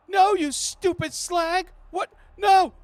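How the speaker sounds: high panicked yelps as he is attacked; frightened man. Acted. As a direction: beg and curse in a squeaky panicked voice. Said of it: "you can hear the men's like".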